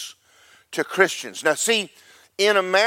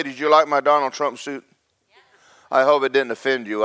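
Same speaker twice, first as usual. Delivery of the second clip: ordinary talk, nothing marked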